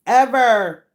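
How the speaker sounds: insisting the friend never left her son, stressing Ever; emphatic. Real, not acted. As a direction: punch Ever as a final word. Emphatic repetition.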